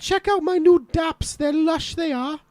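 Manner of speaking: high-pitched, lilting Welsh voice; Welsh accent. Acted. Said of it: "now I'll say in a sentence".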